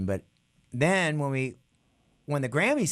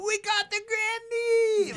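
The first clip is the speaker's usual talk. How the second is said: high, slow, drawn-out triumphant call; excited yell; much slower than usual. Retold or imitated. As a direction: shout the news across the lot. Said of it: "And I go".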